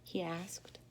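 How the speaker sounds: breathy whispered tag. A narrator's reading. Narrator whispering the tag beside crying Katy. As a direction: keep it a whisper.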